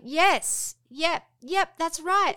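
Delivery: over-eager yes to save the lie; eager agreeing. Retold or imitated. Mum assuring daughter reindeer are real, loud eager.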